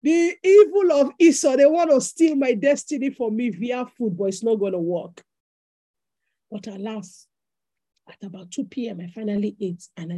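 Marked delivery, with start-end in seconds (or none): 0.0-5.2 mock rebuke. Mock spiritual warfare over food.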